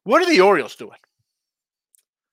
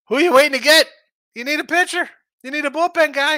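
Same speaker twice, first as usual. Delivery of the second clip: much higher and louder, frustrated demand at the team; exasperated rant; 10 semitones higher, 7 dB louder than usual. Real, not acted. Host berating his team's front office, exasperated and loud. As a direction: bark it at the front office in disbelief.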